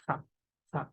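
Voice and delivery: fa as p with a puff of air, repeated; aspirated P. Shown as a demonstration. Teacher repeating P plus H for learners, aspirated fa. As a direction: say fa as pha, each one separate. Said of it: "after adding P H".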